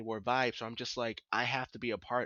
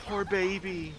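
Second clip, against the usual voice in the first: high, loud affectionate coo; excited cooing; 9 semitones higher, 6 dB louder, slower than usual. Real, not acted. Cooing over a character.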